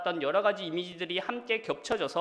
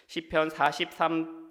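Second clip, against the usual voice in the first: ordinary talk, nothing marked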